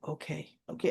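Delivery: low, soft mumbled acceptance; Dante giving in. Retold or imitated. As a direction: yield quietly, voice dropped. Retold Dante yielding.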